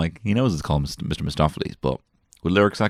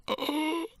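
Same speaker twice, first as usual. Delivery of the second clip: imitated child gasp; 9 dB quieter than usual. Son reacts to story.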